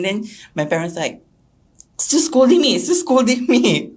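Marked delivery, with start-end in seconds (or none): 1.9-3.9 upset raised complaint. Retold or imitated. Retelling crying complaint.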